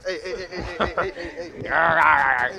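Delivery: repeated calling out. Real, not acted. Coworker shouting hey repeatedly.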